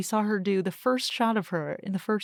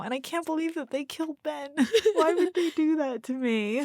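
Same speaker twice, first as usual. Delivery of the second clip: tearful; 8 semitones higher than usual. Real, not acted. Still crying over the ending.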